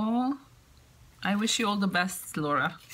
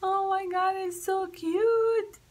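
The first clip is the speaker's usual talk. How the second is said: high-pitched, adoring coo; delighted gushing; 10 semitones higher than usual. Real, not acted. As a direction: gush in a high voice over something cute. Gushing over googly-eyed cats.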